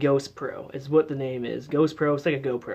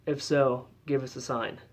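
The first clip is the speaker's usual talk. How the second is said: ordinary delivery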